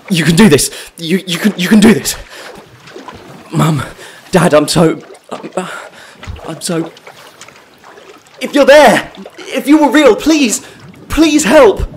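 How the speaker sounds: high, loud, breaking pleas; gasping for courage, then begging; desperate pleading. Acted. Drowning man calling to Mum and Dad, desperate.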